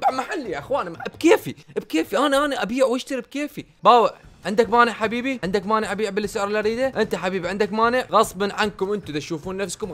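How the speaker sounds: my shop, my prices, with a smug laugh; defiant boss. Pretend. Defiant shop owner to customers. (صاحب المحل يتحدى الزبائن بتعالٍ وضحكة)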